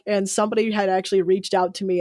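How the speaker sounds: ordinary delivery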